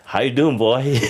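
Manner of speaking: high, loud hammed-up American accent, laughing at himself; a clumsy American accent done for a laugh; bad American accent. Boxer mocks his own failed American accent at auditions, hammed-up American accent. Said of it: "doing an American accent".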